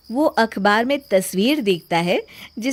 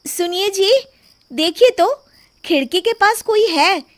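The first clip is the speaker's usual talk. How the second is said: high, raised call to a husband; put-on worried wife calling; pretend alarm; 9 semitones higher, 4 dB louder than usual. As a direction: call out so the thief overhears. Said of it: "जानबूझकर जोर जोर से बातें".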